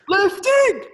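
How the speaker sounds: high, loud chant-leader call; hyped crowd warm up. Acted. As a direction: shout the cue to fire up the crowd. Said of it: "when I say lifting, you say things".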